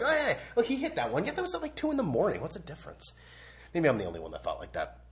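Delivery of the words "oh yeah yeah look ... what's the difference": high-pitched, offhand imitation of a fan; dismissive East Coast fan. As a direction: wave it off in a higher voice. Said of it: "It's just like".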